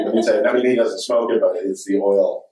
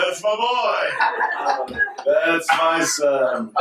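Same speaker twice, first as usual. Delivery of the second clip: high, exultant retold cheer, slowing at the end; proud crowing; 8 semitones higher, much slower than usual. Dad cheering his son's comeback, proud and elated. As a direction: boast it like a delighted dad.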